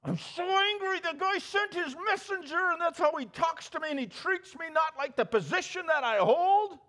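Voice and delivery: high, indignant complaint; mock his wounded pride, pitched high; indignant Naaman. Preacher mimics Naaman's complaint, high and indignant. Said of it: "Say what?".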